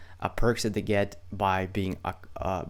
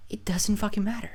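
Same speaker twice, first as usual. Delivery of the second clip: whisper; 11 semitones higher than usual. Real, not acted. Aside about unsubscribers.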